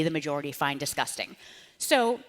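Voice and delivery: normal talking voice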